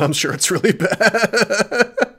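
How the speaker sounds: high, loud, slow laughing speech; real laughing. Real, not acted. Streamer amused by own bad accent, breaking into laughter. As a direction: crack up while talking.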